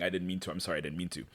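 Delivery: soft, low mock sobbing apology; retold sobbing. Host retelling Cassie's sobbing apology, soft and low. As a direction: murmur the apology like a weeping girl. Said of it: "she starts sobbing".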